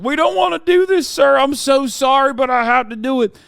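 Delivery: high, pleading, apologetic whine; tearful mock cop. Host mocking teary cops perp-walking Trump, high tearful pleading. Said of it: "They have tears in their eyes".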